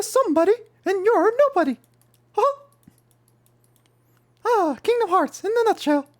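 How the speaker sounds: very high, loud squeaky cartoon mouse; Mickey Mouse voice. Streamer riffing on Kingdom Hearts in a squeaky mouse voice.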